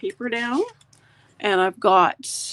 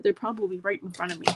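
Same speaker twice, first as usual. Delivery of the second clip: ordinary talk, nothing marked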